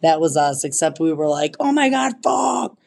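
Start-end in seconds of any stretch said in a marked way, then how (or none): 1.6-2.8 panicked yell. Retold or imitated. Running to plane in storm.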